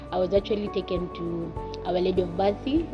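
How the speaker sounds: ordinary talk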